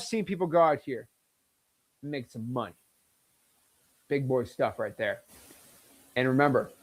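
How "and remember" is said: ordinary talk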